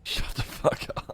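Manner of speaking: hushed whisper, no voice; whispered jab. Real, not acted. Friend teasing during Italian bit.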